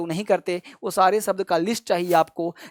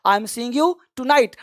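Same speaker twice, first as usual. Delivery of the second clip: "I am seeing you tonight" read very slowly, word by word; slow dictated example; much slower than usual. Shown as a demonstration. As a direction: dictate the sentence slowly to students. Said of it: "जैसे हमने कहा".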